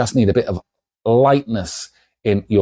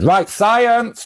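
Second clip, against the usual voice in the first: excited announcement; 11 semitones higher than usual. Acted. Comic shop owner launching the experiment, mock grand. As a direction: proclaim it like a game show host.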